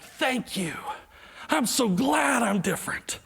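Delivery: mock gratitude. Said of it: "That's a lie".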